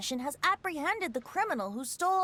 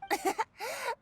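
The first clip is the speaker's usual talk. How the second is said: a short, airy, higher chuckle; light chuckle; 8 semitones higher than usual. Acted. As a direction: laugh it off, amused and unbothered. Bard laughs off Paimon's jab.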